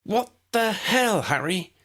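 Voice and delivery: high female voice, scolding in disbelief; Ginny's voice. Acted. Ginny scolds Harry, high and slow.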